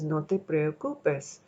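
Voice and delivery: slow, unhurried Spanish; Spanish phrase. Retold or imitated. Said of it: "I always hear this in Spanish".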